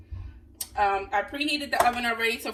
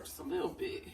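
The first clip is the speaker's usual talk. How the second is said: a breathy whisper to herself, pitched up; whispered aside; 6 semitones higher, 10 dB quieter than usual. Shown as a demonstration. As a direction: whisper it playfully while pouring. Measuring seasoning, talking to herself.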